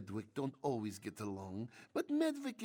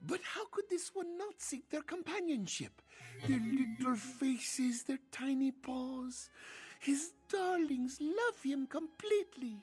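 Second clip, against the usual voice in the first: Ishu doting voice; 14 semitones higher, 3 dB louder than usual. Acted. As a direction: linger fondly over each word.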